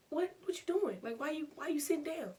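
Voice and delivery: high-pitched confused imitation; puzzled brother. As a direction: act out a baffled brother questioning her. Brother answers door, puzzled.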